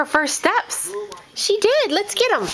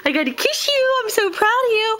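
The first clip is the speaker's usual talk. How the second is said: ordinary talk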